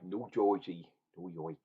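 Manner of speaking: rough attempt at a New York and New Jersey accent; amateur New York accent. Shown as a demonstration. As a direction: try out a New York "oi" vowel, "York" as "Yoik". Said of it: "a New York accent".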